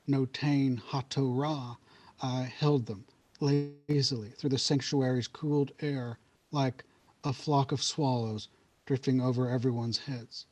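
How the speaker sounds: ordinary talk, nothing marked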